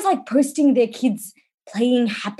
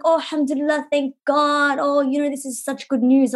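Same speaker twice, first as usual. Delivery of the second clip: lightly voiced son, higher, faking relief; mock-innocent kid voice, only lightly done; son's fake relief; 6 semitones higher than usual. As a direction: play the son acting overjoyed, a little higher. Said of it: "He's like".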